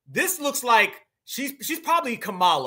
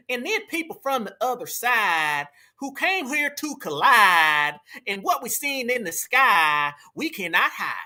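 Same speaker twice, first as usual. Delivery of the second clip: mock Southern accent. Reactor mocking the woman's speech, exaggerated Southern accent. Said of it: "That is a W woman Southern accent".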